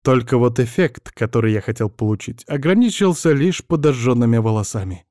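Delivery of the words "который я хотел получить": ordinary delivery, nothing marked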